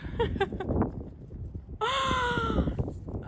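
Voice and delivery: high, long giggle; real amused giggle; delighted laugh. Real, not acted. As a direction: laugh at the cheeky card. Reads funny card aloud.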